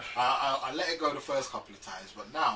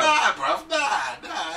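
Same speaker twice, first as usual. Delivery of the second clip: shouting; 12 semitones higher, 11 dB louder, slower than usual. Real, not acted. Heated argument denial.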